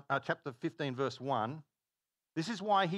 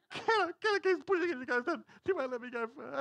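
mock sobbing little girl; imitating a sobbing child; 11 semitones higher than usual. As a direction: wail it like a heartbroken little girl. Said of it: "she's sobbing".

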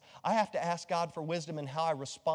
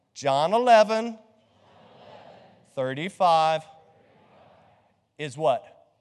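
louder and slow, ending on a question; louder call and response; 3 semitones lower, 6 dB louder, slower than usual. Shown as a demonstration. Pastor quizzing the congregation on the verse, loud and slow. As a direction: prompt the crowd to answer back. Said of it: "you repeat after me".

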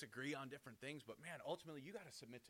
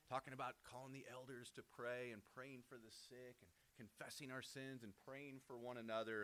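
ordinary talk

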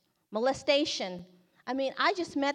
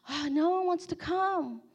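higher-pitched whine of disappointment, imitated; let down; 6 semitones higher than usual. Girl runs back to report nobody will come, let down.

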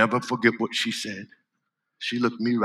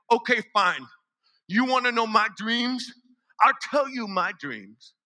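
frustrated; 11 semitones higher than usual. Acted. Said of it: "so I got frustrated with her".

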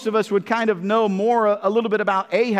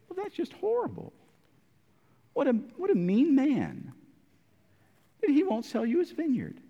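high, softer mock-soothing wife; mock sympathy; 5 semitones higher, 7 dB quieter than usual. Jezebel soothing Ahab, soft mock sympathy. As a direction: humor him sweetly. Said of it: "Jezebel comes in and says".

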